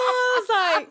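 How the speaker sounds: high, loud helpless laughing; real laughter. Real, not acted. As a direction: break up laughing at the memory. Lipstick on teeth story.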